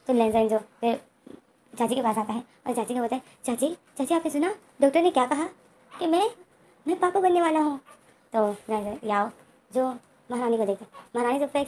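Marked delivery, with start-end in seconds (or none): 3.4-7.8 put-on rising higher with excitement, childlike; excited news. Len Den tells aunt he'll be a papa, excitedly. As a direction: Len Den bursting to tell his aunt.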